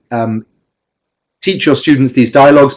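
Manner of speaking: ordinary talk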